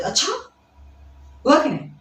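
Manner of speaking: अच्छा lifting into a surprised rise. Shown as a demonstration. Teacher imitating someone surprised at something, mildly surprised. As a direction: say अच्छा as a mild, rising exclamation of surprise. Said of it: "surprise हो गया किसी चीज को".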